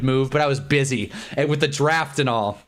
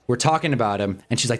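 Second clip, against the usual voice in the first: ordinary talk, nothing marked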